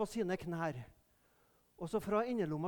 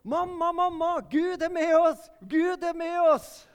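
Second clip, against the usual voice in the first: put-on high, loud, joyful cry of a little boy; excited child shout; 10 semitones higher, 9 dB louder than usual. Little boy running to his mother, shouting with joy.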